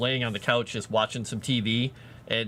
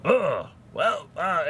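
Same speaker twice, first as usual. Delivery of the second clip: higher raspy character imitation; Beetlejuice; 7 semitones higher, much slower than usual. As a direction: stall in a put-on ghoul voice. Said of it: "I started doing that more".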